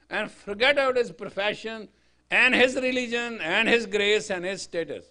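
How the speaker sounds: fiery preaching. Acted. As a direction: hammer it home to the crowd like a preacher. Preaching at full force.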